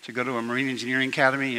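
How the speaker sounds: normal talking voice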